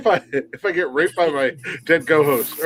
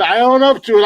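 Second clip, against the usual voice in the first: emphatic admission; 8 semitones higher, 6 dB louder than usual. Real, not acted. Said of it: "I can't do voices and impressions".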